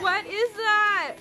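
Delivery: ordinary talk, nothing marked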